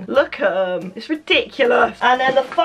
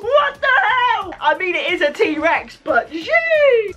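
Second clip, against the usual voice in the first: much higher, laughing, slow disbelieving squeal; real delighted shriek; 11 semitones higher, slower than usual. Real, not acted. Unboxing the giant duck for viewers, shrieking with delight. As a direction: burst out with real joy at the size.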